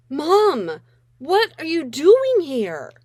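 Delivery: loud, slow, horrified "Mom, what are you doing here?"; mortified disbelief. Acted. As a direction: call her out, stretched in disbelief. Daughter confronts mother at school.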